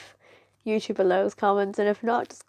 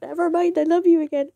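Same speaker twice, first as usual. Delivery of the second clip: high, louder burst of renewed adoration; gushing fangirl; 8 semitones higher, 5 dB louder than usual. Retold or imitated. Fan hears the character sing and relents, gushing. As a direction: gush it, instantly won back. Acting out sudden change of heart.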